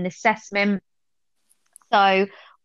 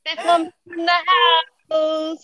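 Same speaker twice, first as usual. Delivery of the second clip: high, slow hype-man call; 7 semitones higher, 3 dB louder, much slower than usual. Pretend. Introducing a friend as her MC, slow loud hype call. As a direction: announce her like a show host. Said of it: "Do you want me to introduce you".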